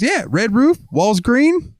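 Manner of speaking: very high, puzzled squeak of "Red roof?"; goofy customer voice. Retold or imitated. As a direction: ask it like a confused cartoon character. Odd customer's pun.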